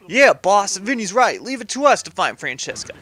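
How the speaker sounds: keen mob sidekick; eager henchman. Acted. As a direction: chime in eagerly to back up a crony. Voices second henchman chiming in.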